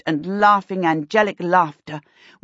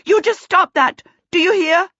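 high, scolding shout; 9 semitones higher, 4 dB louder than usual. A narrator's reading. Woman racing upstairs after the chase, shouting. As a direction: shout it as a sharp order.

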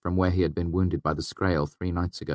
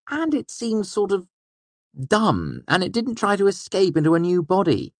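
put-on higher, lively young trickster voice; young fey man voice; 4 dB louder than usual. A narrator's reading. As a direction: voice Bast higher, quick and sly. Bast voiced by narrator.

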